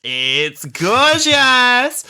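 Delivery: high, loud, drawn-out gushing; Donna. Retold or imitated. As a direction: squeal it, totally besotted. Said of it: "that bit that Donna goes".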